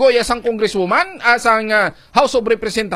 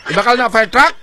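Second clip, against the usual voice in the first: louder, laughing through the words; 4 semitones higher, 7 dB louder than usual. Real, not acted. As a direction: ask it while still laughing. Host laughs at own joke.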